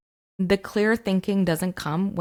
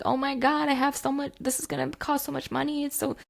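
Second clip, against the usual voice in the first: much higher, panicked husband over the phone; mock yelling husband; 9 semitones higher than usual. Husband on the phone panicking about the cost, high mock panic. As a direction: squeal like a stressed-out husband. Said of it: "my husband's on the phone yelling".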